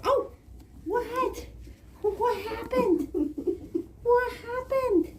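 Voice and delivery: very high, slow coo. Real, not acted. Clinician asking an upset baby what happened, high baby talk.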